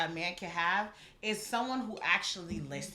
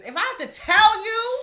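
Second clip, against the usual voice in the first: higher and louder, boiling over with real anger; real angry outburst; 12 semitones higher, 13 dB louder than usual. Real, not acted. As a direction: let the irritation burst out loud. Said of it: "I'm getting angry".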